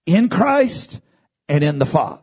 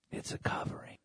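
whisper; 23 dB quieter, faster than usual. Real, not acted. Whispered aside in sermon.

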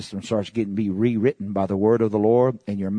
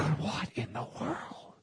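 hushed amazement; 4 semitones higher, 12 dB quieter, slower than usual. Retold or imitated. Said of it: "You go".

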